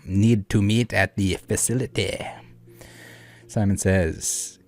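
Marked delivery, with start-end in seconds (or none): 0.0-2.2 slow, hammed-up French drawl; put-on French accent. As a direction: read it as a bad French impression. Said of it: "I don't know why he's turning French".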